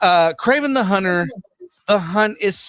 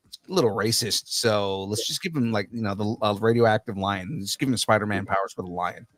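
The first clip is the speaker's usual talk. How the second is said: lightly voiced executives, lower and softer; imagined studio executives; 6 semitones lower, 5 dB quieter than usual. Podcaster imitates studio planning a lion hero, lightly voiced in a low, soft tone. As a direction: talk it over quietly, a touch lower. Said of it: "what they're doing is they're like".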